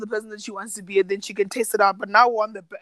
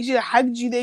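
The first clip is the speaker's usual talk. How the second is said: ordinary talk, nothing marked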